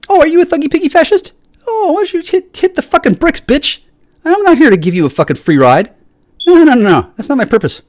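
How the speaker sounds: high, taunting put-down voice throughout; mock sneering retort. Acts out his retort to critics.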